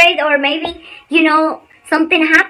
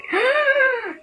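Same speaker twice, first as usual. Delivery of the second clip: imitated gasp; 12 semitones higher, 4 dB quieter than usual. Acting out the actor's fright.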